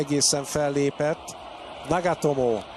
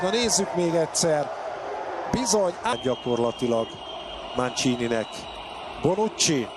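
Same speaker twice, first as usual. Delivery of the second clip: ordinary talk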